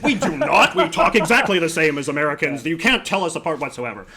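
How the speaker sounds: loud, high, hammed-up protest; exaggerated defensive outburst; mock indignant denial. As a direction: act out an indignant denial with a laugh in it. Mock Canadian denial of accent.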